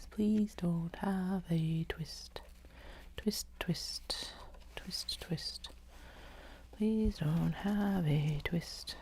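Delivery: slow soft whispered plea; whispered pleading. Real, not acted. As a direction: beg it softly, slowly.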